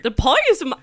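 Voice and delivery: loud mock Irish accent cracking into laughter; attempted Irish accent. Shown as a demonstration. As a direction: have a go at Irish, giggling. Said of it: "[name], you do it".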